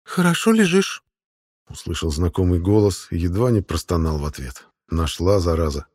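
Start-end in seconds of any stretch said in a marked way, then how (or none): 0.0-1.0 put-on high-pitched young woman's voice; девушка Мариона. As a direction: speak as the girl, pitched far up. (высокий девичий голос)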